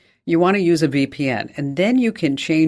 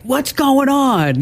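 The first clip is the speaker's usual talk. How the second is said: much higher, slow, drawn-out puzzled cry; mock bewildered; 11 semitones higher, much slower than usual. Host imitating herself when the power hiccups, mock bewildered. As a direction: stretch it out like you're baffled the lights flickered. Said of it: "when I'm like".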